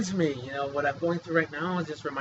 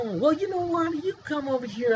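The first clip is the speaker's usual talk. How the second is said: challenging; 10 semitones higher than usual. Real, not acted. Challenging a listener.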